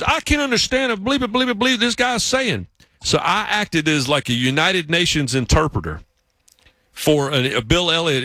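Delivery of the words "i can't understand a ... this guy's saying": high-pitched mock exasperation; exasperated coworker. Coworker baffled by the interview tape, high mock exasperation. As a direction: complain in a high, put-on voice. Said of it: "and he goes".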